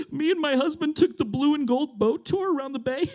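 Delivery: high-pitched tearful wail; hammed-up weeping tourist; mock crying. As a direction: imitate a woman sobbing out her story. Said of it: "immediately starts crying, and she goes".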